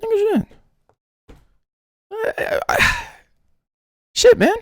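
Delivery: very high, squeaky mock rapper cry; squeaky exclamation. Mock rapper cry, squeaky and very high.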